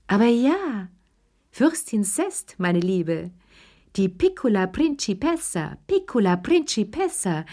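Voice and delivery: put-on boasting to the old ladies about her prince; proud gushing. A narrator's reading. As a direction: savour "Piccola Principessa" up high.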